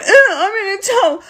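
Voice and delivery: high, whiny mocking Trump voice, a laugh in it; lifted, whiny Trump parody; mock Trump whine. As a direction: sneer it like a petty tattletale. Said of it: "Trump was like".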